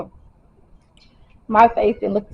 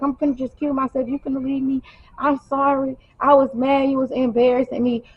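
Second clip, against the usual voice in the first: higher-pitched mock apology and pleading; imitating frantic boyfriend; 5 semitones higher than usual. Boyfriend acted, threatening and apologizing.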